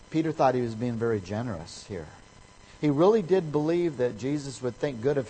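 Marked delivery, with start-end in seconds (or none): none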